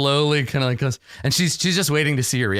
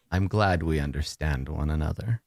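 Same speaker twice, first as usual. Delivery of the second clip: satisfied mistress; 8 semitones lower, 5 dB quieter than usual. Acted.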